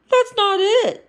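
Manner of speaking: high, loud firm denial; insisting. Acted. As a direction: push back, she has it wrong. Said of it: "insisted Kermit".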